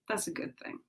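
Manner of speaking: whispered, soft encouragement; whispering. Real, not acted. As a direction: whisper it gently.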